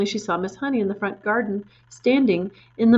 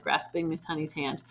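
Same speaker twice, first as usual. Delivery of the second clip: usual speaking voice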